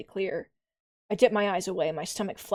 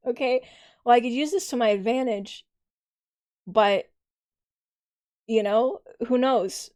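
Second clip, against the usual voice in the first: squeaky voice; 3 semitones higher, 5 dB louder than usual. Real, not acted. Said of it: "my voice is so squeaky right now".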